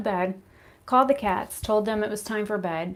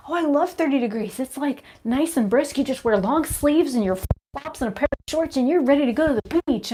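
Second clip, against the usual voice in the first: higher, louder chirpy parody voice; hammed-up cheerful northerner; mocking cold-lovers; 6 semitones higher, 4 dB louder than usual. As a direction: gush like someone who loves freezing weather. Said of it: "they're like".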